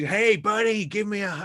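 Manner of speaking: high, loud hearty Texan greeting; imitating a big Texan. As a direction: boom it out like a big friendly Texan lad. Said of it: "These are big Texan lads".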